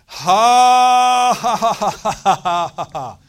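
very loud high forced laugh, fading down; driving, laughing at the growth; laughing by faith. Retold or imitated. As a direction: belt out a defiant laugh. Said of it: "and I'd say".

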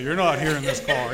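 a laughing brush-off to God in the car. Retold or imitated. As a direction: toss it back with a laugh. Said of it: "It's easier for you to say".

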